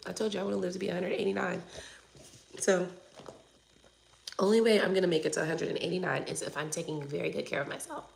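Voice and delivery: ordinary talk, nothing marked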